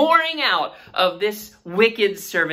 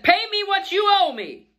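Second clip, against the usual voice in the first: sharp, much higher demanding voice; harsh demand; 13 semitones higher than usual. Retold or imitated. Wicked servant choking his fellow servant, high harsh demand. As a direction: bark it like a greedy creditor. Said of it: "and said".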